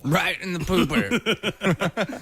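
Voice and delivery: high, slow words through laughter; laughing. Real, not acted. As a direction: squeal it out while cracking up. Laughing at the joke.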